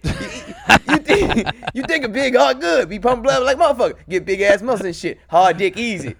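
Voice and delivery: high, loud comic rant; hammed-up voice, pitched well up; put-on comic character. Riffing on a big heart pumping blood, hammed-up character voice.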